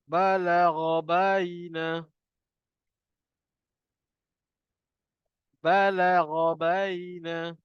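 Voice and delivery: very slow drawn-out Quran recitation. Shown as a demonstration. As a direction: recite slowly for students to repeat. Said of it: "ikut saya baca".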